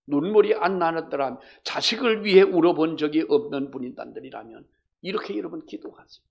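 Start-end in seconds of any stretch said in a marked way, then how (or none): none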